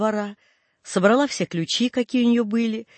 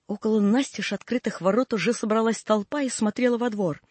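plain reading voice

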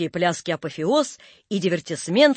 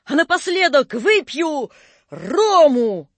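high, ringing stage declaration; bold bravado; 6 semitones higher, 4 dB louder, slower than usual. A narrator's reading. Napoleon announces a last drink, bold bravado. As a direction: announce it with swagger to the crowd.

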